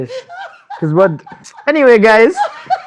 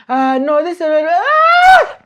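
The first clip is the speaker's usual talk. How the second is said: high startled cry stretched out slow; shocked yelp; 11 semitones higher, 5 dB louder, much slower than usual. Retold or imitated. Friend retelling the slap, loud shocked yelp. Retelling getting slapped, yelling.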